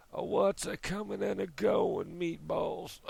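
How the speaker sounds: put-on a half-hearted, botched Italian accent; Italian Bill Clinton. Vlogger attempts a botched Italian Bill Clinton impression. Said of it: "my Italian Bill Clinton".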